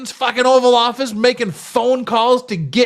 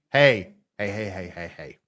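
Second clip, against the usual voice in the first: mock voice; 12 semitones lower, 6 dB quieter than usual. Mock chant after flannel joke.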